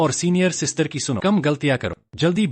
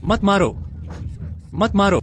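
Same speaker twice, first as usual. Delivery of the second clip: pleading shout; 6 semitones higher than usual. Acted. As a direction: yelp it out to stop the blows. Being hit after helping her.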